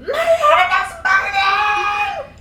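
high, drawn-out, hammed-up shriek; scream. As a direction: scream it slowly at full pitch. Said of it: "I screamed".

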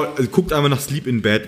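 normal talking voice

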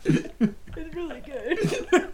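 amused mock approval. Pretending to eat road.

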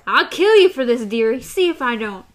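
loud, venomous threat; hateful threat. A narrator's reading. Gasher threatening Jake, loud and hateful. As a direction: spit the threat at him.

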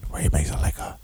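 breathy ASMR whisper, unvoiced. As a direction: whisper close to the mic like an ASMR video. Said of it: "I can't do that A S M R shit".

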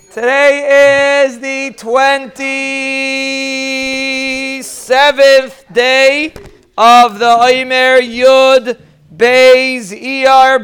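very slow, stretched-out words held on a level pitch; drawn-out announcement. Real, not acted. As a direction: draw out the count like a ceremonial announcement. Announces the day's Omer count.

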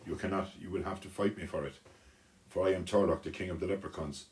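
usual speaking voice

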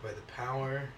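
hushed mock ceremonial tone; solemn regal knighting. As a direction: dub him like a playful king.

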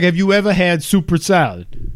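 slurred "soup or salad" in mock New Yorker; New York accent. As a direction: ask about soppressata in a put-on New York accent. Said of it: "He's like".

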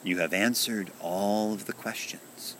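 plain reading voice

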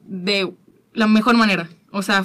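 ordinary delivery

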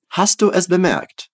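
ordinary talk, nothing marked

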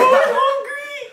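high whiny wail, laughing; mock bawling. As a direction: whine it like a crying, starving kid.

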